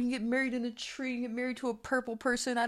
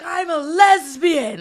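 much higher, louder, slow, each word landed; bold declaration; 9 semitones higher, 12 dB louder, much slower than usual. Retold or imitated. Daughter coming out to her mother, loud and slow. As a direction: announce it boldly. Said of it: "I was like".

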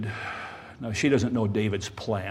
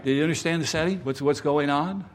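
ordinary talk, nothing marked

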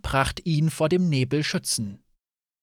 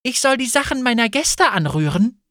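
high, louder outraged question; affronted, raised-voice protest; hot indignation; 7 semitones higher, 5 dB louder than usual. A narrator's reading. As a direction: throw the suggestion back in disbelief. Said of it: "von heißer Empörung erfasst".

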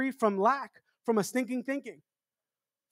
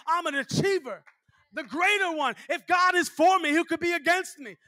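preaching at full force; 5 semitones higher than usual. Acted. Preacher proclaiming victory to his congregation, fervent. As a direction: build to a victorious shout.

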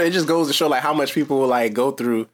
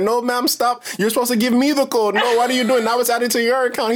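higher, exasperated caller voice; frantic scammer; 10 semitones higher than usual. Retold or imitated. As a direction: imitate the scammer losing control of the call. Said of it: "They be like".

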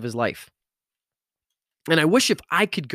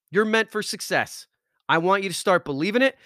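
rousing pep talk; 7 semitones higher than usual. Acted. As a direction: fire them up and keep climbing to the peak. Continued pep talk climax.

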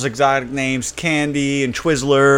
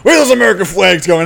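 mock cop; 4 semitones higher, 6 dB louder than usual. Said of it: "the cop was like".

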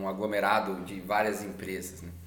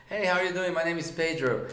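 higher, upbeat phone opener; chirpy sales greeting; 5 semitones higher than usual. Retold or imitated. Cold-calling a customer, acted cheery greeting. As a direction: greet the customer with lifted pitch. Acting his sales call opening.

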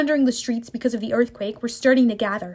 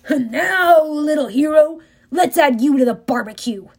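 menacing war god; 3 semitones higher, 3 dB louder than usual. Acted. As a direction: savor the threat. Ares threatens Percy.

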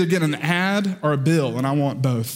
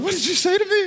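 much higher, startled wife voice; shocked wife; 13 semitones higher than usual. Retold or imitated. Wife Lauren reacting to misheard word, high shocked squeak. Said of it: "Lauren's like".

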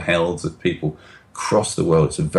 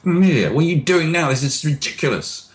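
self-scolding; 10 semitones higher than usual. Retold or imitated. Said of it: "beat ourselves up".

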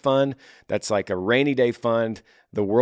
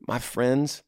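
normal talking voice

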